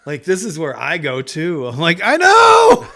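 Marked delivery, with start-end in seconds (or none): 2.0-2.9 very high, loud comic yell; frantic shout. Retold or imitated. Answering the complaining cat, frantic shout. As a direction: shriek it back in exasperation.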